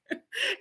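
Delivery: a short, high, soft giggle; quick high giggle. Real, not acted. Talking about her tech nephew, a quick giggle. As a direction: let a little laugh slip out.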